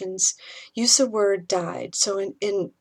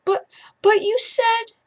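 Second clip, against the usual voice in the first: very high, louder, starting to crumple; a child about to burst into tears; upset little boy; 7 dB louder than usual. Acted. Ethan protests to his mother as tears come, high and loud.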